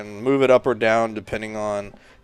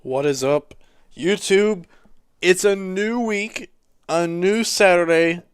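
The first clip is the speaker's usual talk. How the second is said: hyped intro; 8 semitones higher, 5 dB louder, slower than usual. Real, not acted. YouTube intro greeting.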